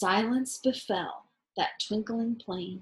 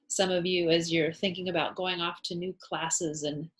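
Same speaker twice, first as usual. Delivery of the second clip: ordinary delivery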